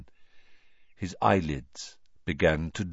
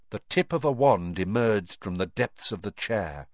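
plain reading voice

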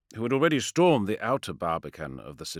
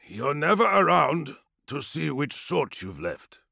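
higher, slow parting remark; slow, weighty warrior; 6 semitones higher, slower than usual. Acted. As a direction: let it land slowly. Hema's parting line.

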